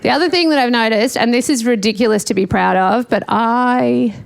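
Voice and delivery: ordinary delivery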